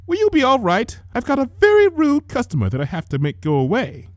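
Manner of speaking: a hint of a fussy older sister; gentle big sister tending a sick sibling; Rarity's voice. A narrator's reading.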